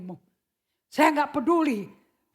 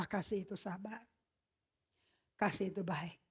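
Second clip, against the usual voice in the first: gentle quote; 5 semitones lower, 13 dB quieter than usual. Acted. Quoting changed Paul's words.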